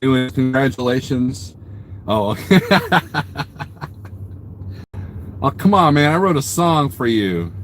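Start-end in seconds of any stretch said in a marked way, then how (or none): 2.6-5.4 real laugh trailing away; helpless, amused laughing fit; burst of laughter. Real, not acted. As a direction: crack up and let it tail off.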